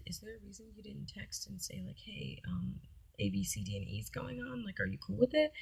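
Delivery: whispered, pointed question; hushed, pointed recollection of the argument; hushed retelling. Retold or imitated. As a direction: whisper the complaint tightly. Retold confrontation, whispered.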